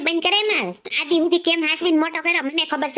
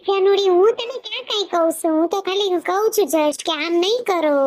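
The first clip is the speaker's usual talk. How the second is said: high, pleading husband's voice; placating husband; 5 semitones higher than usual. Acted. Husband placating his wife, high and pleading. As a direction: plead your innocence, pitched up.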